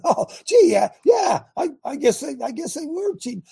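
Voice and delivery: high, mocking "Oh, gee" with a laugh; sarcastic mock surprise. Real, not acted. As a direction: feign dawning realization, dripping sarcasm.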